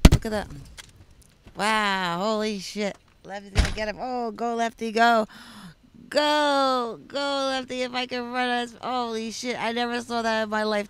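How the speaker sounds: loud, thrilled cheering of a chase; excited cheering. Real, not acted. Friend watching the chase, cheering Lefty on loudly. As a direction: yell him on as it unfolds.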